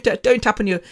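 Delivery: normal talking voice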